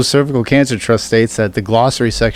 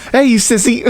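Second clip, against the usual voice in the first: mock bully taunt; 11 semitones higher than usual. Mock bully taunt, shouted laughing.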